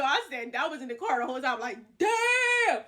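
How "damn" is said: very high, loud, stretched-out exclamation; hammed-up shock, held long and high; astonished shout. Seeing a surprise Cash App payment, astonished shout.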